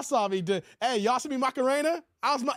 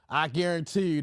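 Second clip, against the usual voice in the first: ordinary delivery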